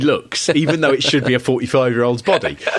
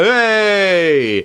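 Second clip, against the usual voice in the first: higher, louder fan's call; a fan reacting by reflex to the call, higher and louder; mock crowd shout; 7 semitones higher, 4 dB louder than usual. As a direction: call out from the stands. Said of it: "you go".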